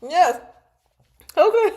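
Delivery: high, loud cheer; excited yes. Real, not acted. Thrilled the commenter got the reference, cheering high and loud. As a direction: cheer it out in triumph.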